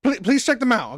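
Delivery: usual speaking voice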